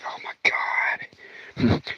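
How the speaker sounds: slow, barely voiced groan of dread; dismayed whisper. Hiding husband reacts to the neighbor's call, dismayed whisper. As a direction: whisper it slowly so nobody hears.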